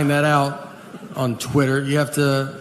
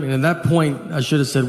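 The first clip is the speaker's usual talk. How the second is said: ordinary talk, nothing marked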